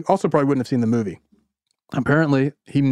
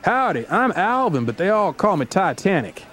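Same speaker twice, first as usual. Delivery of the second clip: sing-song Missouri twang, pitched up; 6 semitones higher than usual. Acted. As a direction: greet them in a folksy Missouri twang. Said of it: "says in his charming Missouri twang".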